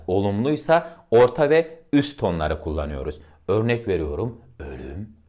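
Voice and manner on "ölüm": said low and soft, heavy. Shown as a demonstration. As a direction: drop the voice low and soft for ölüm. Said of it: "Bakın birazcık kalın tonlar kullanıyorum".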